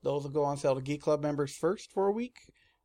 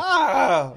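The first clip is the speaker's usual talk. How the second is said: mock scream; 4 semitones higher, 9 dB louder than usual. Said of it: "it's like".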